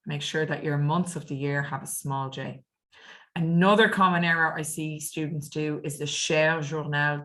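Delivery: ordinary talk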